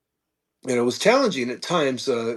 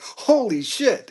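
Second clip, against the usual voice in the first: drawn out, much higher and slow, in disbelief; retelling his amazement at the first desert rosy boa; stunned holy shit; 11 semitones higher, 4 dB louder, much slower than usual. Retold or imitated. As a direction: let it out slowly, amazed and pitched way up.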